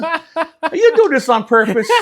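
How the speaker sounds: high, laughing playful accusation. Real, not acted. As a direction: tease him through a laugh. Teasing accusation over lucky rolls.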